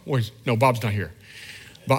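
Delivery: normal talking voice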